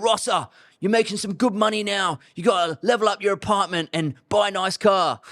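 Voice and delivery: imitating a posh-ish English HR manager; English manager urging an upgrade, in an English accent. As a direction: put on your best English accent. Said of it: "That's my best accent".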